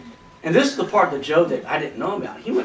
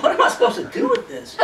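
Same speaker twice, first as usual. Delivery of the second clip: breathless laughing question, much higher. Retold or imitated. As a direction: gasp it out through laughter, pitched high. Laughing retelling own bafflement.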